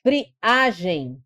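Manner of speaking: friagem split as fri, A-gem; pause, then stress the a in friagem; stressed syllable singled out. Shown as a demonstration. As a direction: show the strong A in friagem. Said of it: "aqui é o A".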